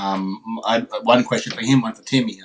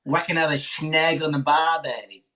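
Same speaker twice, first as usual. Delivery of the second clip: slow, higher Australian drawl; broad Australian accent, hammed up; Aussie accent; 5 semitones higher, slower than usual. Shown as a demonstration. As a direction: lay the Aussie cliché on thick. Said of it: "Aussie accent".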